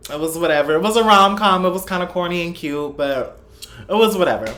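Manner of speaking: talking while chewing gum; words round a wad of gum. Real, not acted. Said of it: "sorry I have gum in my mouth".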